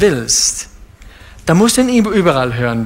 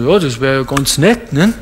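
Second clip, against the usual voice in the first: a lower, offhand and slightly bored voice; indifferent believer; 3 semitones lower than usual. Retold or imitated. The preacher plays a believer brushing off God's voice, mildly indifferent. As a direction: shrug it off lightly. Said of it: "Dann sagen sie".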